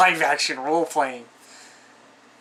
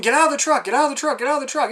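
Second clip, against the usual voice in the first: retold screaming; 9 semitones higher, much faster than usual. Characters screaming at the man in the truck, high frantic mock yell. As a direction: yell it over and over at the guy in the truck. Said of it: "And they're just screaming".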